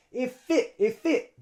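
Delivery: "If it" as one word, "iffit"; linked F. Shown as a demonstration. Teacher shows F linking to I, joined up. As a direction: carry the F onto "it". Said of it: "the F links onto the I".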